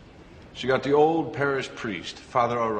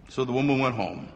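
ordinary delivery

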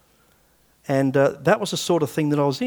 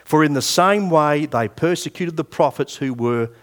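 slow, deliberate Bible reading; measured pulpit reading, drawn-out stressed words; scripture reading; slower than usual. Acted. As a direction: read each phrase out weightily, with pauses. Reads scripture aloud to the congregation.

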